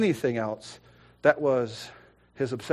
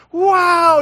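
high, loud awestruck exclamation; amazed excitement; 13 dB louder than usual. Retold or imitated. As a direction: marvel at it in full voice. Retold amazement at raised hands.

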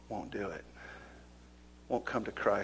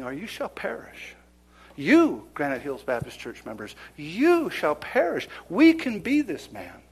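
preaching at full force; 5 semitones higher, 5 dB louder than usual. Acted. Preacher warning Granite Hills members, high and forceful. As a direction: call doom on the congregation. Preacher warns church loudly.

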